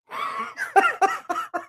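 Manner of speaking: laughing. Real, not acted. Cracking up at the old man's dead German joke, real high laugh.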